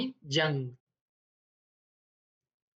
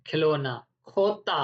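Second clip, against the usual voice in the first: ordinary talk, nothing marked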